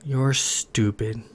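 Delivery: soft, slow and hushed; quiet voice. Acted. Sam quietly answers Kay from a back pew. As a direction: say it softly from behind him. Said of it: "a quiet voice say".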